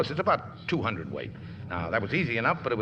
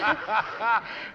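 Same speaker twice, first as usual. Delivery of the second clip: high, relieved laugh; happy laugh; 10 semitones higher than usual. Acted. Charlie laughing with Rosie after the sinking.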